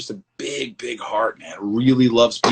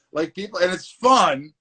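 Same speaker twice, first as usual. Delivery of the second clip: ordinary talk, nothing marked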